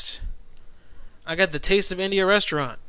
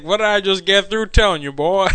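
imitating the caller's scolding line, a little louder; 4 semitones higher, 6 dB louder than usual. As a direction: parrot the caller's scolding, half-hammed. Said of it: "He's like".

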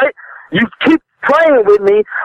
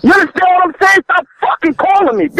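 angry shout; 7 semitones higher than usual. Real, not acted. Furious seller yells at the prank caller, shouting. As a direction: blow up and shout him down.

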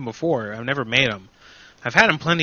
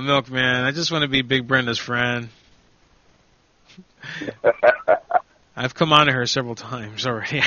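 ordinary talk, nothing marked

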